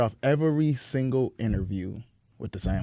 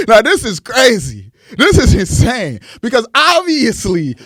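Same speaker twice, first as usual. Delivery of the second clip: loud, higher hype-man introduction; hype man intro; 8 semitones higher, 6 dB louder than usual. Acted. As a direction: pump up the crowd for the chief. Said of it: "My own hype man on payroll".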